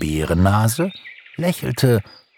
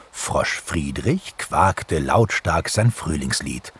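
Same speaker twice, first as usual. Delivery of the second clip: ordinary delivery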